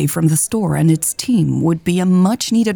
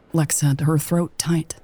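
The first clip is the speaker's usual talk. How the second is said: ordinary delivery, nothing marked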